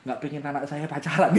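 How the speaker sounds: ordinary talk, nothing marked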